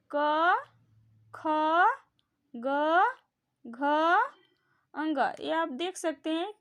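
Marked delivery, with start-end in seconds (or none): 0.1-5.2 loud, clear demonstration of each consonant; sounding out the letters. Shown as a demonstration. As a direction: say each letter slowly and distinctly from the throat. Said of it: "खुद उच्चारित करके देखो".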